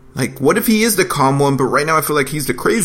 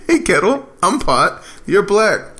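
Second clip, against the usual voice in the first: mock catchphrase; 7 semitones higher, slower than usual. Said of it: "That was, like, your thing".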